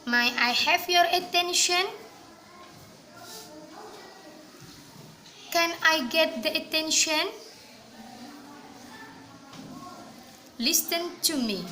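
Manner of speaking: slow, clear model phrases to repeat; careful pronunciation demo. Shown as a demonstration. Teacher modelling English phrases for students, slow and clear. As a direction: say each phrase slowly for students to copy. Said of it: "Is it clear?".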